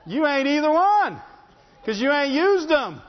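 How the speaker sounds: high, loud punchy rebuke; preacher at full force; preaching rebuke. Real, not acted. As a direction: hit them with it hard.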